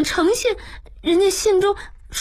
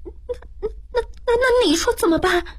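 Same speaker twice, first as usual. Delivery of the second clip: high stammering question, faint at first; frightened. A narrator's reading. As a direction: stammer in fear, then rush it out. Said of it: "喜凤害怕了". (害怕得结结巴巴，尖声发问)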